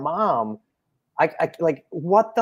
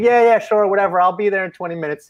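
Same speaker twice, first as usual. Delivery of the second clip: louder, higher, fast brush-off; dismissive dad; 7 semitones higher, 4 dB louder than usual. Retold or imitated. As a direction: toss it off like a dad who isn't listening. Dad brushing off daughter's request.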